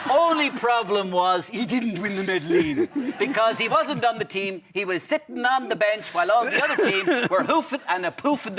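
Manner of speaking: hammed-up veteran broadcaster, pitch leaping and swooping; lively TV host parody with swooping emphasis; Late Late Show host impression. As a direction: play the old-school chat show host congratulating a guest. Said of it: "There is one Late Late Show host".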